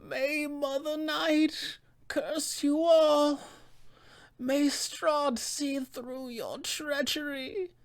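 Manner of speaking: dying witch's curse, high, soft and slow; Baba Lysaga. Acted. Dying witch cries to the heavens, high drawn-out curse. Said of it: "she will cry out to the heavens".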